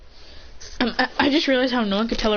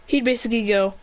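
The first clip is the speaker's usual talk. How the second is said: ordinary delivery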